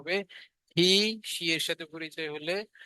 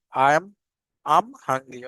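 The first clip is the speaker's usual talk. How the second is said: slow, not fluent; 8 dB louder, much slower than usual. Shown as a demonstration. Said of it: "আমরা হচ্ছে এখনো ওই accent, আহ, উচ্চারণ করছি না".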